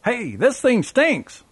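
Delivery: high, slow drawn-out call across the room; young father calling out to his wife, high and slow mock yell. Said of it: "then yelled to his wife".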